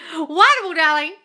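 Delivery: high, very loud and thrilled; delighted praise. Real, not acted. Coach congratulating a follower on movement, delighted cheer. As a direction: cheer them on exuberantly.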